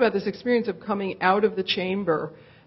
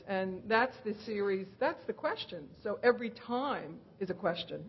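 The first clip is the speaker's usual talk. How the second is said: normal talking voice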